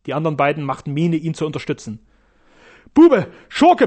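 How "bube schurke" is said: roared high and loud; roaring. Acted. Frankas roars insults at Sternau, high and loud. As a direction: bellow the insults. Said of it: "brüllte Frankas".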